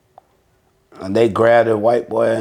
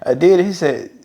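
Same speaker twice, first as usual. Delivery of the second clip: normal talking voice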